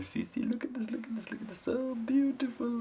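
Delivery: awed narrator; marvelling. A narrator's reading. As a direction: show the child something amazing.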